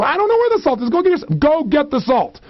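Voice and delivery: high-pitched squabbling protest; acted exasperation. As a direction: argue back in an exasperated high voice. Acted squabble over finding the salt.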